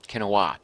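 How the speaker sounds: "Quinoa" said as kin-oh-AH; trying out pronunciation. Shown as a demonstration. Streamer testing the viewer's version, "Quinoa" as kin-oh-ah. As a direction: say "Quinoa" in three syllables, kin-oh-ah, falling. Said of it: "he thought it was quinoa".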